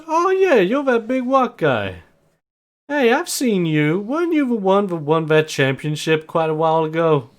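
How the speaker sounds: high mocking voice; mocking champ. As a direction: sneer at the big guy. Champ sizes up rival.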